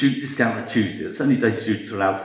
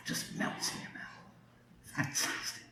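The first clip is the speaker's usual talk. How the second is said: soft breathy whisper, lingering over the taste; savouring whisper; 19 dB quieter than usual. Real, not acted. Baker whispering to the audience, savouring the bread. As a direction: whisper it like you can taste it. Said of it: "I get a bit excited about this stuff".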